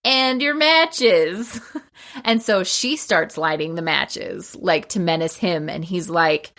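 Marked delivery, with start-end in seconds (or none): 0.0-1.6 slow and higher, drawn out with relish; menacing tease. Retold or imitated. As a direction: savour the threat. Blind wife threatens the villain.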